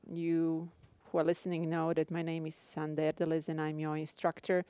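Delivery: ordinary talk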